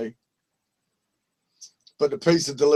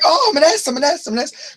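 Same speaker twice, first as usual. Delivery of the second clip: put-on excited girlfriend; 11 semitones higher, faster than usual. Excited girlfriend in retold call.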